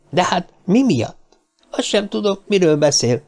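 high-pitched, flustered protest; scared denial. A narrator's reading. Little man cornered by Cartwright, alarmed high-pitched denial. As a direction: plead ignorance in rising alarm. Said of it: "A kisember halálra vált arccal".

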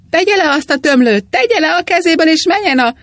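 enraged gardener shouting threats; angry shouting. A narrator's reading. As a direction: bellow at the priest to drop the hose. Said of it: "kiabálta".

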